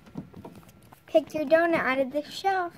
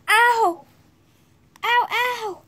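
higher, loud mock cries of pain; play-hurt yelps, hammed up; a pretend mother cat crying out as kittens come; 6 semitones higher, 7 dB louder, slower than usual.

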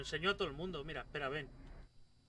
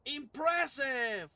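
hammed-up; 6 semitones higher, 5 dB louder, much slower than usual. Hammed-up exclamation.

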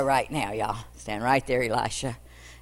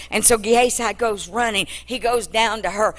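ordinary talk

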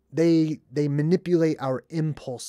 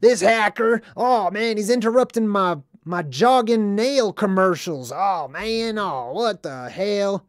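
slow, louder, higher gruff complaint; grumpy homeless guy; 5 semitones higher, 4 dB louder than usual. Retold or imitated. Vlogger imitating the irritated homeless guy, louder and gruff. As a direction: grumble like a cranky old bum. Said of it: "exactly how he comes across".

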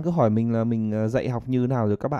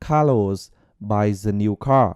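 slow linked demo; 3 semitones lower, 3 dB louder, much slower than usual. Shown as a demonstration. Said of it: "ví dụ mình sẽ nói là".

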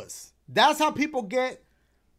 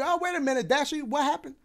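high, fussy imitation of a fan; mock worried fan; 6 semitones higher, faster than usual. Imitating fans who ask what happened, in a high mock-worried voice. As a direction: play a fretting viewer asking what happened. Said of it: "I'm still gonna get people saying".

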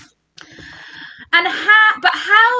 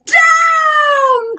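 very high, louder drawn-out shout; witch's cry; 11 semitones higher, 5 dB louder than usual. A narrator's reading. Witch ordering the broom down, high falling cry. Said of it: "cried the witch".

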